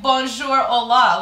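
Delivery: hammed-up multilingual hello; imitated mother greeting. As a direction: call out greetings like a social queen. Mother greeting staff, acted.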